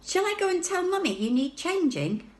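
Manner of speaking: put-on high, wondering girl's voice; astonished, asking gently; little girl Laura. A narrator's reading. Sister puzzled by talking baby, high and wondering.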